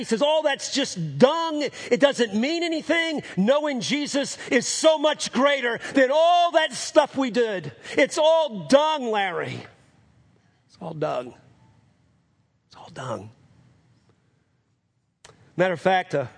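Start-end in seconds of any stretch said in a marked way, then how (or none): 0.2-9.7 high, slow, drawn-out cry; impassioned declaration. Retold or imitated. Convert telling his brother Larry it's worthless, crying out fervently. 10.7-13.3 falling away to quiet finality; hushed repetition. Retold or imitated. Convert repeating it to his brother, hushed.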